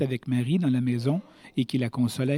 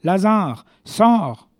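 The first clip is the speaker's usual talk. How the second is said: calls out loud and high, commanding; a ringing command across the tomb; loud cry; 6 semitones higher, 6 dB louder, much slower than usual. Acted. Jesus calling Lazarus out of the grave, loud commanding cry. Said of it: "il cria d'une voix forte".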